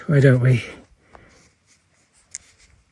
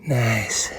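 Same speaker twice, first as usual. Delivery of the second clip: whispering; 3 semitones lower, 6 dB quieter than usual. Real, not acted. Quiet admiring of rocks.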